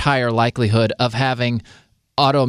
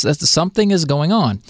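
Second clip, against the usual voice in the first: ordinary talk, nothing marked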